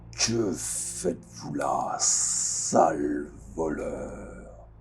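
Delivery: deep, slow, booming guardian voice; low and slow, echoing through the city; cavernous echoing voice. Acted. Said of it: "une voix caverneuse qui a l'air de résonner".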